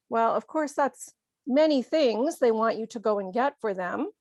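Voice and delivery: normal talking voice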